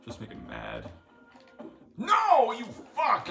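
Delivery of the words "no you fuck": screaming in rage at the enemy; furious yell. Real, not acted. Gamer screaming at an enemy that ruined his run, furious yell. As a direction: explode at the bug, full volume.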